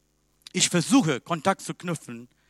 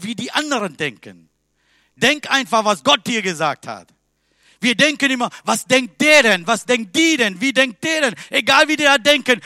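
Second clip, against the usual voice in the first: loud, much higher, driving sermon exhortation; full-force preaching; 7 semitones higher, 10 dB louder than usual. Acted. Preacher urging his congregation to obey God, loud fervent preaching. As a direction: hammer the message home to the pews.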